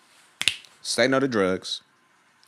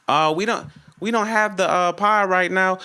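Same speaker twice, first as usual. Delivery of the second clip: lightly voiced high-pitched, hesitant clerk; drive-thru worker; 8 semitones higher, 3 dB louder than usual. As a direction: apologize as a mock fast-food worker, pitched up. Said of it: "They're like".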